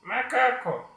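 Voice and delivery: high puppet voice saying "macaco" in one quick run; high puppet, syllables run together; unseparated puppet speech. Shown as a demonstration. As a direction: say "macaco" straight through as the puppet, without breaks. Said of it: "e não assim".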